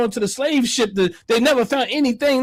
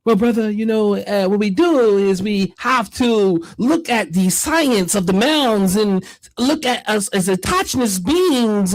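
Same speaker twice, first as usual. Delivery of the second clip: mock philosophizing; 3 semitones higher than usual. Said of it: "They start sounding like Stokely Carmichael".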